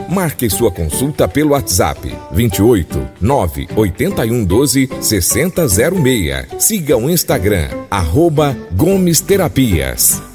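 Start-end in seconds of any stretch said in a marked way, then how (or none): none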